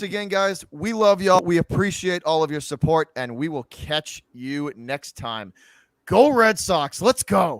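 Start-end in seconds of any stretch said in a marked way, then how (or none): 6.1-7.6 team cheer. Real, not acted.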